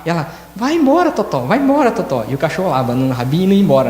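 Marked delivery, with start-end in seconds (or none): 0.5-2.2 sweet dog talk. Retold or imitated. Woman shooing her dog Totó, higher sugary coaxing. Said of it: "A hora que ela mudou o tom".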